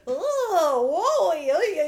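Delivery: high, loud, laughing flustered gasps; goofy flustered Carrie. Retold or imitated. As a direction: ham up a flustered cover-up. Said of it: "She was like".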